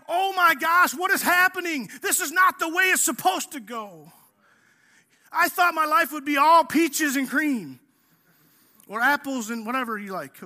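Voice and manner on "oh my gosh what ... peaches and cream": higher, mock panicked whining; panicked complaint. Believer complaining that life went wrong, higher mock panic. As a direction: whine about life going wrong, pitch raised. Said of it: "we're like".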